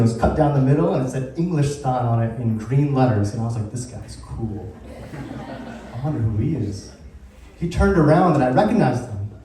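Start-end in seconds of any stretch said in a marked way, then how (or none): 3.7-6.9 low, slow, soft musing; hushed thought. Retold or imitated. Musician privately admires a stranger in the green room, hushed. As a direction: murmur it to yourself, slowly.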